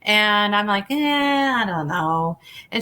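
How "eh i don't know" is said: hesitant retold brush-off, dropping at the end; reluctant. Retelling her doubt to a friend urging the class, reluctant.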